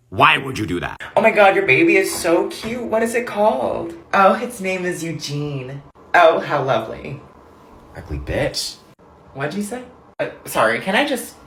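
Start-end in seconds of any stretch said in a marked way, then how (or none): 1.2-7.2 fake polite chat. Acted baby naming chat. 8.0-8.8 much lower, much softer muttered insult; muttered aside. Acted. Snide aside about the baby, low and much softer. 9.3-9.8 suspicious question. Acted. Other person overhears insult.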